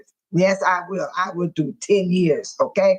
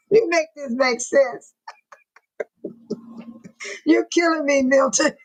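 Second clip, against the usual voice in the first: laughing; 4 semitones higher than usual. Real, not acted. Laughing at a viewer's comment.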